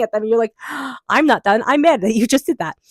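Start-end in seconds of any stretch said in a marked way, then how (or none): 0.6-2.8 a gasp, then a louder, higher mock-mad kid; mock tantrum. Reader voicing a child's tantrum, gasp then mock anger. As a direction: huff like a child mid-tantrum. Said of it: "that little kid ... temper tantrum".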